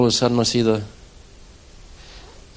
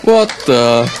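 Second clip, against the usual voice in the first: startled; 5 dB louder than usual. Retold or imitated. Retelling his own sudden roar.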